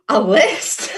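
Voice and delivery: gasping in delighted shock, laughing; high-pitched gasp, then slow laughing words. Real, not acted. Shocked gasp at the list reveal.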